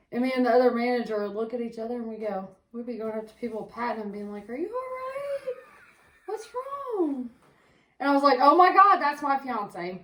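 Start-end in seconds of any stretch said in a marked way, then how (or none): 4.5-7.3 high, slow, teary-sounding concern; hammed-up caring voice, high and slow; mock soothing. Mock comforting crying coworkers. 8.4-10.0 a hammed-up gasp of recognition; mock shock. Acting out sudden recognition.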